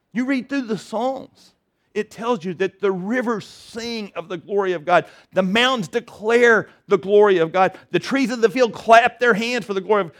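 normal talking voice

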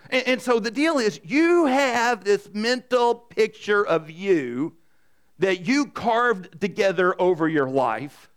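ordinary talk, nothing marked

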